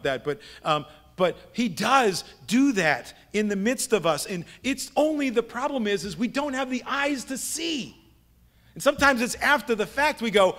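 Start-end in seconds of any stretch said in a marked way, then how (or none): none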